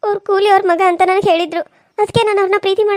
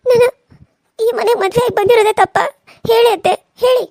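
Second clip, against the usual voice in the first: desperate plea; 6 semitones higher than usual. Acted. Begging mother-in-law for answer.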